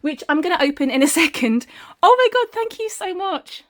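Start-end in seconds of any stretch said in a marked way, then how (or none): 2.0-3.6 overjoyed exclamation. Real, not acted. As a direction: gasp it out, thrilled by the gift. Overwhelmed by gifted compost.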